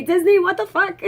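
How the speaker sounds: high, loud exasperated cry at Disney; mock outrage. Real, not acted. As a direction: throw up your hands at Disney.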